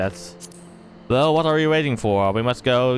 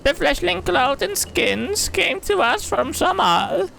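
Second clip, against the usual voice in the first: high creature voice. Acted. Villager greeting the great hunters, very high and squeaky. Villager recounts the fat trader.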